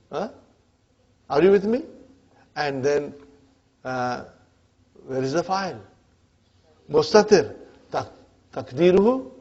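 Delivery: ordinary delivery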